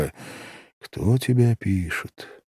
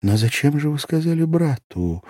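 much higher voice, gently bewildered; puzzled; 8 semitones higher than usual. A narrator's reading. Girl asking why he told her brother, puzzled. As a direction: ask it high and perplexed. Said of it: "с недоумением повторила Ася".

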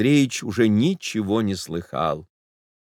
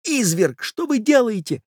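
high, strained voiced stage whisper; horrified hushed protest, pitched up; urgent whisper; 12 semitones higher than usual. A narrator's reading. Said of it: "прошептал молодой человек".

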